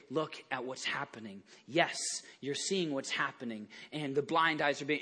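low, soft reassurance; gentle reply. Retold or imitated. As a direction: answer quietly and kindly. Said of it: "Jesus responds with".

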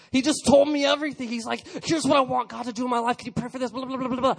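loud, high, fired-up retelling; preaching climax. Real, not acted. Pastor to youth group, shouting with excitement. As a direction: belt it out like a preacher at full tilt. Preaching builds to full force.

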